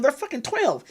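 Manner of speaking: high, disbelieving inner rant; incredulous inner thought. Retold or imitated. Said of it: "in my mind, I'm thinking".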